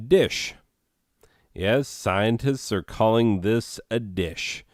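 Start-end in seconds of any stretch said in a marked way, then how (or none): 0.1-0.5 sudden exclamation. Real, not acted. Reveals the disappointing creature.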